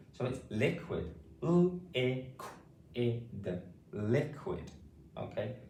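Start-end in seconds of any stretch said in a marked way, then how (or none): 1.4-3.5 L, I, Q, I, D sound by sound; phonics-style L, I, Q, I, D. Shown as a demonstration. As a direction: segment it with short gaps between sounds. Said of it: "saying these sounds and words".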